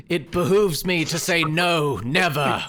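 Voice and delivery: loud, slow, hammed-up refusal in character; defiant captive villain. Game master answers as threatened man.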